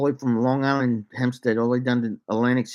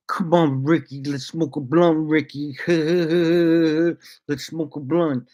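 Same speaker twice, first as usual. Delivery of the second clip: slow, lazy stoner voice; coworker's stoned invite; 4 semitones higher, slower than usual. Retold or imitated. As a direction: drag it out like a stoned buddy. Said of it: "coworker voiced".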